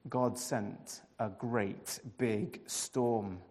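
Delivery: ordinary delivery, nothing marked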